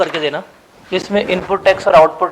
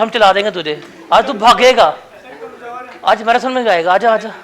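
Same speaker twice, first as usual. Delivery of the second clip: louder, higher, jokey coaxing; loud teasing; 3 semitones higher, 7 dB louder than usual. Real, not acted. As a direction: egg him on loudly in fun. Teacher loudly teasing hesitant student. (ज़ोर से छेड़ते हुए बुलाना)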